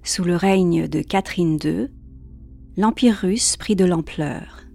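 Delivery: ordinary talk, nothing marked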